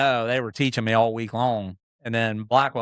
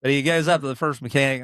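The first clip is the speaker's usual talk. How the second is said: usual speaking voice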